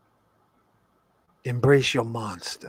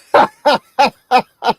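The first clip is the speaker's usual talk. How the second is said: big hearty laugh; loud laugh; 11 semitones higher, 11 dB louder than usual. Real, not acted. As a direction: burst out laughing. Laughs at own joke.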